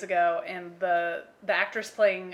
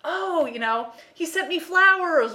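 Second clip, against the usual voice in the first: higher, louder mock gushing; deluded admirer; 9 semitones higher, 6 dB louder than usual. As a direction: gush like a deluded admirer who believes it.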